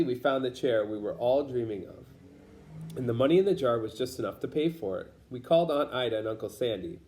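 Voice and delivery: ordinary delivery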